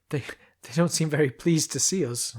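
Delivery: stammering and a little shaky. Acted. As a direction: let the nerves catch the first word. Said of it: "observed Alkland shakily".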